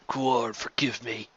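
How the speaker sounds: slow, soft dying plea; a slow, hushed prayer for forgiveness; whispered. Acted. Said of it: "he whispered".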